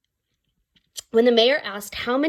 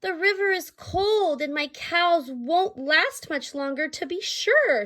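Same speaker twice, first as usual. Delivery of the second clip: high desperate plea; 8 semitones higher than usual. Acted. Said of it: "said the stranger".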